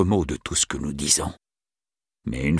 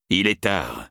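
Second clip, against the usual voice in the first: ordinary delivery, nothing marked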